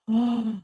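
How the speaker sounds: short audible gasp. Real, not acted. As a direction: gasp in reaction to a threat. Reacting to the texted threat.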